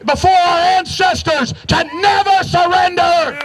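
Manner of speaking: high, impassioned oration; rousing speech. Real, not acted. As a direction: preach it like a solemn pledge. Solemn rally pledge oration.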